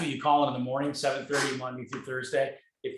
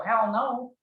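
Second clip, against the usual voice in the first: much higher and louder, pumped-up retort; retold hyped reply; 8 semitones higher, 4 dB louder than usual. Speaker hyping his friend up, in a high excited voice. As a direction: fire it back with excitement. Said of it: "I go".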